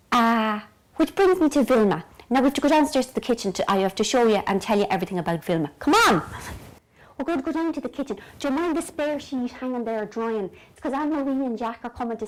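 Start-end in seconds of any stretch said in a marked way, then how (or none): 5.8-6.2 loud, high call to follow. Real, not acted. Host leading viewers to the kitchen, calling out. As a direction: summon them along, raised voice.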